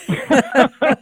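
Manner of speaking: loud high laugh; a real burst of laughter at his own quip. Real, not acted. Guest laughing at his own joke about Presbyterians.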